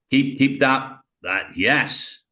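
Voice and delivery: usual speaking voice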